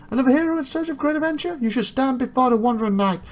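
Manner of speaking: high, fast theatrical knight; grandly hailing knight. Acted. Knight greeting a hero seeking adventure, high proclamation.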